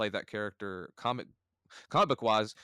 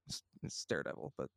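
whispered confession, much softer; 8 semitones lower, 12 dB quieter than usual. Real, not acted. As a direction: lean in and whisper the secret. Confiding his real favorite.

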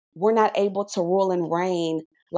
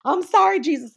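flimsy sorry; 10 semitones higher, 4 dB louder than usual. Retold or imitated. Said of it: "not just".

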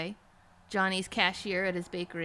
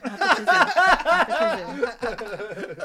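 laughing anguish; 2 semitones higher, 11 dB louder, slower than usual. Acted. Acted anguished line.

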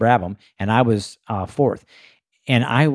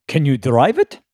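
lightly imitated German accent, pitched up; Tom; 5 semitones higher than usual. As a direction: ask it with a hint of German accent, higher. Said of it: "he does have a German accent".

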